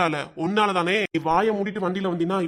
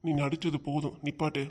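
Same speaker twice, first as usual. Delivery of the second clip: cold low rebuke; 4 semitones lower, 9 dB quieter than usual. Acted. Husband cuts off wife's act.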